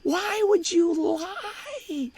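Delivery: high, drawn-out, pained question; mock anguished wail. Reporters asking him why he lied, mock anguished wail.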